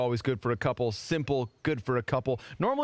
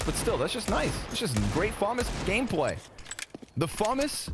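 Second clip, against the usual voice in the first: high, hammed-up radio announcer voice; American radio announcer; 8 semitones higher than usual. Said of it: "Why are you talking like that?".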